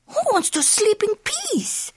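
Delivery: squeaky, very high kitten question; incredulous kitten. Acted. Skipito questioning the doggies, incredulous. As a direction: ask it way up high, baffled.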